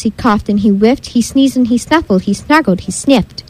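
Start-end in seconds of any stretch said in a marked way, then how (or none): none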